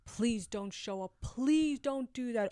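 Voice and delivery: slow, drawn-out plea; begging. Retold or imitated. Woman begging him by phone not to show up, pleading. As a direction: plead with him, stretching each please. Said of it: "I mean, begging".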